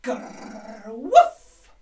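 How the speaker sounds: playful dog noises; dog growl and woof. Shown as a demonstration. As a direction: growl then a loud bark. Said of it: "Can you do it?".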